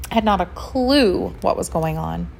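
usual speaking voice

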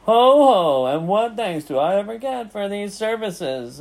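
high, loud mock laugh then complaint; scornful. A narrator's reading. Rat grumbling about thankless services, scornful. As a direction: sneer with a swooping ho, ho.

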